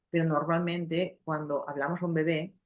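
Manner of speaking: ordinary talk, nothing marked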